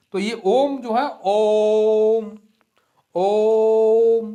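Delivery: Om stretched long and loud; ओम sung out long like a chant; prolonged Om. Shown as a demonstration. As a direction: hold the ओम slowly, drawing it out. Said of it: "जैसे कि हमने बनाया यहाँ पर ओ".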